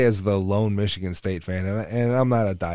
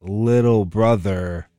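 slow dramatic buildup; 3 dB louder, slower than usual. Real, not acted. Dramatic build to rival loss.

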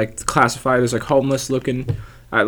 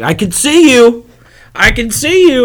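high, loud, drawn-out mock scream; loud impression of a roommate screaming in his sleep; imitated night-terror scream; 11 semitones higher, 8 dB louder, slower than usual. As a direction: cry out like someone in a night terror. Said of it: "screaming at the top of his lungs".

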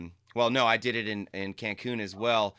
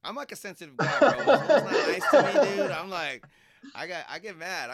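laughing; 9 semitones higher, 11 dB louder than usual. Real, not acted. Laughing at his own sensitivity.

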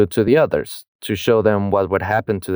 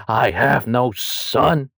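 slow, raised theatrical proclamation; mock disowning father; 4 semitones higher, much slower than usual. Father denying his son before everybody, hammed-up solemn denial. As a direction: draw out each word, hammed-up and higher.

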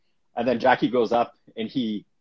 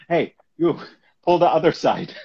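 loud, high call; 6 semitones higher, 7 dB louder than usual. Retold or imitated. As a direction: shout across the table. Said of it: "he'd be like".

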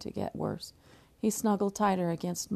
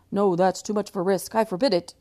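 stern; 6 dB louder than usual. Acted. Imagined stern father forbidding.

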